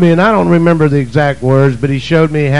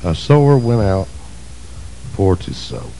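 quiet reflective reading; 7 semitones lower, 6 dB quieter than usual. Shown as a demonstration. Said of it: "What's that mean?".

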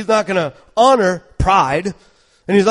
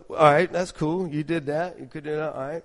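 softer, lower, dismissive fading reply; fading muted sarcasm; unimpressed reply; 3 semitones lower, 7 dB quieter than usual. Retold or imitated. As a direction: shrug it off quietly. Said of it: "And I'm going".

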